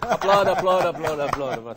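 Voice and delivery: loud rallying call for applause. Real, not acted. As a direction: rouse the room to clap. Host calls for applause.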